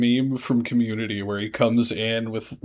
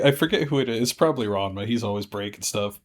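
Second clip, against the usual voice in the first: normal talking voice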